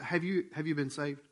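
soft, grave question; dead serious. Retold or imitated. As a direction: imitate a solemn, hushed inquiry. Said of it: "just as serious as can be".

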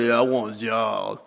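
mock a half-dressed job seeker; lazy mock voice with a laugh; lazy applicant. Mocking a sloppy applicant.

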